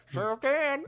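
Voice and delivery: lightly voiced sheriff; mock sheriff answering. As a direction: answer the phone in character. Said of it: "He goes".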